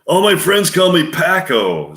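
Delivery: put-on nasal New York accent, pushed up high; New York Al Pacino impression. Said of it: "Al Pacino in a New York accent, nasal voice".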